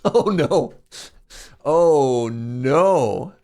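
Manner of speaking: loud laughing groan; real amused despair. Real, not acted. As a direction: laugh out the dismay. Laughing at pronunciation mix-up.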